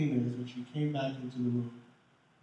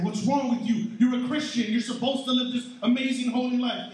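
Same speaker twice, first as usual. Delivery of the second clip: higher, louder mock rebuke; mock scolding; 8 semitones higher, 6 dB louder than usual. Pastor mocking church people scolding new believers, loud rebuke.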